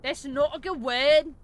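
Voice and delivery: over-the-top English accent; obnoxious English accent. Shown as a demonstration. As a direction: sneer it in a posh English accent. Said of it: "my really obnoxious English accent".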